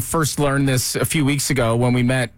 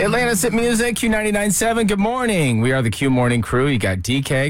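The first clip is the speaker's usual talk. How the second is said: radio announcer; 4 semitones higher than usual. Acted. Radio show opening.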